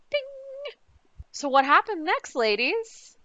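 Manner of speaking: normal talking voice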